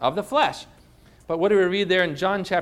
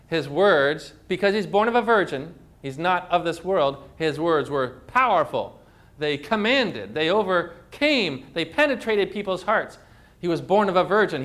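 ordinary talk

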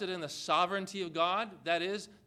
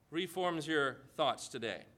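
ordinary talk

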